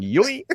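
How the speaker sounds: high, loud "yoink"; cartoon whoosh word. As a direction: punch it out like a comic sound effect. Imitates bike flying from under rider.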